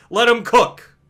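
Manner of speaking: loud, high hype shout; excited shout. Real, not acted. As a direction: belt it out like a cheering fan. Cheering on his character.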